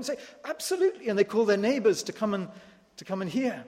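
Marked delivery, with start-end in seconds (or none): 0.4-1.1 high eager "Absolutely"; lightly voiced delighted host; eager agreement. Tanzanian householder agreeing to hear the word, eager.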